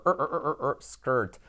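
a long er before skirt; drawn er sound. Shown as a demonstration. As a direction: hold er, then say skirt. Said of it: "esto es er". (er alargado antes de skirt)

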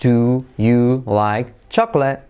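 very slow "Do... you... like... chocolate"; halting, unlinked question; separate words. Shown as a demonstration. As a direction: give each of "Do", "you", "like", "chocolate" its own beat. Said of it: "La diferencia entre decir".

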